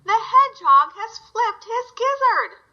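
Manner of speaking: high, slow, jeering goose voice; a gander's high mocking laugh. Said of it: "The gander laughed".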